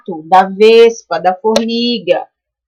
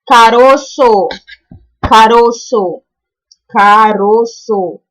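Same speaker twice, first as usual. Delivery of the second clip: put-on teacher slowly sounding out "caroço" for children; drawn-out, deliberate "caroço"; slow, careful enunciation; much slower than usual. Shown as a demonstration. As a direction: say "caroço" slowly, stretching each syllable. Teacher sounding out an example word.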